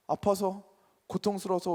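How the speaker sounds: ordinary talk, nothing marked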